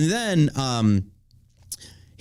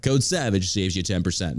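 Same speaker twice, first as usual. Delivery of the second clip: higher-pitched mock commercial announcer; ad read; 3 semitones higher than usual. Host pitching CBD lube to listeners, mock announcer read. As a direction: sell it like a cheesy tagline, dropping on the ending.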